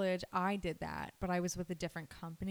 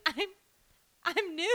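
high, louder laughing protest; giddy protest; 10 semitones higher, 7 dB louder, slower than usual. Retold or imitated. New nurse telling her preceptor she's new, giddy. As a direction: say it half laughing. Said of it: "And I was like".

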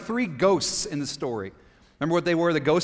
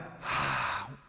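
big breathy sigh out; long exhale; 8 semitones lower, 8 dB quieter than usual. Shown as a demonstration. Preacher leading everyone in a deep breath, long audible exhale. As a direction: let a deep breath all the way out. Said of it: "Let it out".

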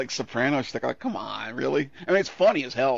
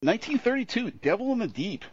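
high, swooping title announcement; showy presenter's film intro; announcer read; 10 semitones higher than usual. Real, not acted. Reads film title to begin.

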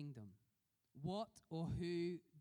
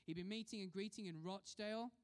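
ordinary delivery

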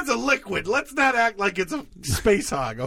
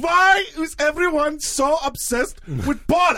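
[name] voice; 7 semitones higher than usual. Retold or imitated. Friend voices [name] complaining about Paul, higher and mock. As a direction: pitch it up as a hammed-up Lennon. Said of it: "[name] voice".

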